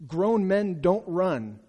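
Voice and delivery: each word weighted, slow; preacher stressing the shame, slow and emphatic. Acted.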